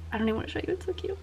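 ordinary delivery

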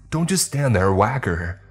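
high, loud urgent command; shouted order. Acted. Worker yells at Toby to whack her. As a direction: yell it desperately.

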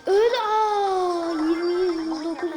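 high wail easing off; dismayed outcry. Real, not acted. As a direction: cry out in real dismay, then settle. Shocked at match slipping away.

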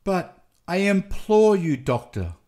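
imploring, falling away at the end; despairing moan. A narrator's reading. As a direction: beg in understated despair. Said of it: "Nelly moaned in despair".

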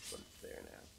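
breathy whisper under the breath; whispered aside. Real, not acted. Player whispering an aside to the audience. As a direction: whisper it to yourself.

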